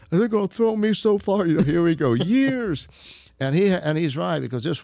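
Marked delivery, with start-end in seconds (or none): 0.1-2.9 retold panic, higher and louder; scared panic. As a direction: pitch it up like a man who fears years in jail. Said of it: "he starts scared".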